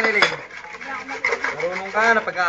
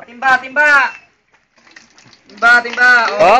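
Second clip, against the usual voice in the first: loud calling for the bucket; 12 dB louder than usual. Real, not acted. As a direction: call out over the yard.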